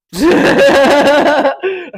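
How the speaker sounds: high, loud laughing fit; laughing hard at the memory; loud burst of laughter. Real, not acted. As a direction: let the laugh explode. Big laugh.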